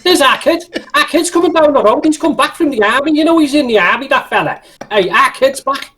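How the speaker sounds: high, chatty Scouse neighbour voice; hammed-up Scouse, announcing news to all; Scouse character. Scouser announcing our kid's back from the army, hammed-up chatter. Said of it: "insulting Scouse name".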